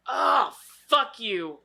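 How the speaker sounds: slow, high, cringing disgust; mock horrified whimper. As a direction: recoil at the memory. Reliving the claustrophobic movie scene.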